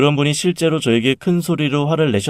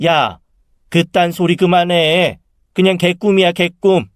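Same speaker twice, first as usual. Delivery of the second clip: higher-pitched, indignant dismissal; angry mother; 7 semitones higher, 4 dB louder than usual. A narrator's reading. Mother dismisses her sister's dream, angrily. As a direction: cut the silly talk off crossly. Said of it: "갑자기 엄마가 화를 내시더라고요".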